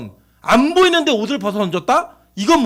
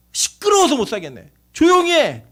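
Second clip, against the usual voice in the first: loud, rising rebuke; scolding; 7 semitones higher, 5 dB louder than usual. Acted. Bystanders scolding the crying blind man, loud rebuke. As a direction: snap at a noisy person to shut up. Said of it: "많은 사람이 꾸짖어".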